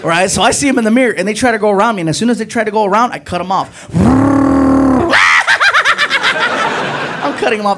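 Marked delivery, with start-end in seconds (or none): 3.9-7.2 big high evil cackle; wicked shouted laugh; loud cackle. Acted. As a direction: cackle loud and long at them. Cackling while cutting them off.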